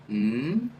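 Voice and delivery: hummed mm gliding upward; rising hum. Shown as a demonstration. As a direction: slide the hum up. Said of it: "make a little rising".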